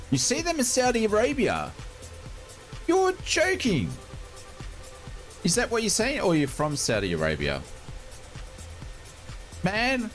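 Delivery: high incredulous outburst; surprised disbelief. Real, not acted. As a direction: squeal it in disbelief. Astonished at viewer's comment.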